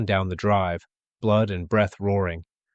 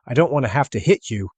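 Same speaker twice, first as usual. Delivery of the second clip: raised, strained warning; desperate warning; 4 semitones higher, 5 dB louder than usual. A narrator's reading. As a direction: warn them, voice raised. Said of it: "he cried".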